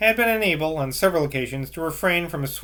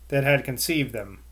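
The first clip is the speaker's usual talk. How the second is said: plain reading voice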